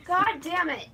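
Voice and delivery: usual speaking voice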